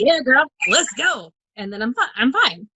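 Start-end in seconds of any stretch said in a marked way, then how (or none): 0.0-1.3 higher, slow, drawn-out self hype; retold pumped up. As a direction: act out psyching yourself up. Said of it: "it pumps me up".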